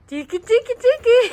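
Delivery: high, loud, rising tickle chant; playful tickling. Pretend. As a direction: tease as if tickling a child. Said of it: "pretend I'm tickling you".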